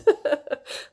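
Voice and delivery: short airy laugh; light closing laugh; real laugh. Real, not acted. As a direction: chuckle as you sign off. Laughs while signing off.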